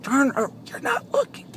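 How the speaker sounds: high, quiet strained voice of a passenger; squeaky mock protest, softly; imagined worried viewer. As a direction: imitate a panicky viewer in a small high voice. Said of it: "some of you would probably be like".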